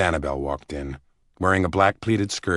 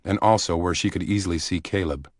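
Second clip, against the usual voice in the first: ordinary delivery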